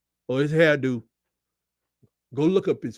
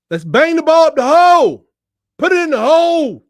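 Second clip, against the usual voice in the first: higher, louder screaming at the game; football voice; 9 semitones higher, 10 dB louder than usual. Real, not acted. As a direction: bellow it at the TV like a fan in the stands. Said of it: "It's my football voice".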